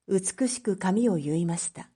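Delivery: plain reading voice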